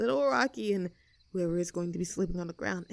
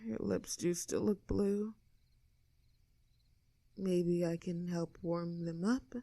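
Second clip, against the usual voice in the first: intimate murmur; 6 dB quieter, slower than usual. Acted. To the friend in the sleeping bag, a slow soft murmur. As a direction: murmur it as you lean in. Leaning in for a kiss.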